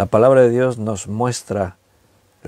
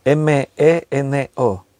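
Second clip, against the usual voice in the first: slow, letter-by-letter spelling of "M E N O"; spelled out; 3 semitones higher, much slower than usual. Shown as a demonstration. Preacher spells a Greek word for listeners, letter by letter. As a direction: spell "M E N O" out slowly, each letter apart. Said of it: "con acento en la E".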